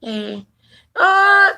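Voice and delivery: normal talking voice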